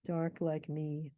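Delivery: "Dark like me" slow with even beats; slow "Dark like me", wait between words; slowed rhythm. Shown as a demonstration. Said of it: "he's slowing it down".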